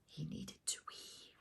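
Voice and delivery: whispered cheeky aside; giggly whispered confession. Acted. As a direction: hush it like a naughty secret.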